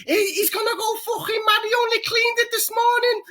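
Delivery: high wailing woman's voice, half crying; imitating the wife. Wife runs out of the house, high mock wail. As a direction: ham up a panicked wife, squealed high. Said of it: "go like".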